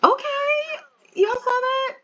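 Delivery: high-pitched, impressed exclamation; excited reaction. Real, not acted. Player cheers viewers on about the shot, excited. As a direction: squeal it, thrilled by the shot.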